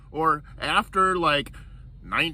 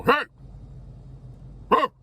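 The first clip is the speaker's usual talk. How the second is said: imitated yell; 10 semitones higher than usual. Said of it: "Some guy ye-, yelling".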